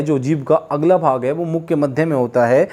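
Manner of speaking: ordinary delivery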